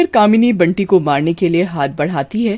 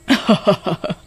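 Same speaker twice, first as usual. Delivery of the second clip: witch cackle. Acted. Witch laughs at Kamini.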